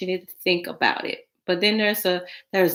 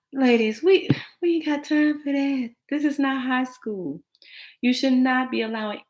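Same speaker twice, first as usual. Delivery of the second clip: exasperated; 7 semitones higher than usual. Real, not acted. Exasperated address to ladies.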